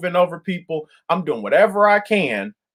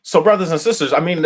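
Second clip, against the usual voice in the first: ordinary delivery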